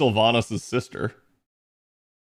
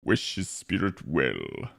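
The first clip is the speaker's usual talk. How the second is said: much lower, slow and softer, like a Tauren; deep Tauren voice; 9 semitones lower, 8 dB quieter, slower than usual. Pretend. Streamer reading a quest line to chat in a low, slow Tauren voice. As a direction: rumble it out slowly like a solemn bull-man elder. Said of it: "I have a deep enough voice for it".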